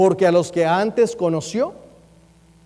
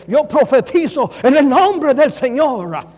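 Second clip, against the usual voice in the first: put-on English-accented Spanish; 3 semitones higher, slower than usual. Preacher mocking Pentecostals who prophesy in an English accent. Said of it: "lo quiere hacer con acento de inglés".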